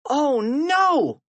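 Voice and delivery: high-pitched cry of alarm; dismayed cry. A narrator's reading. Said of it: "cried Harold".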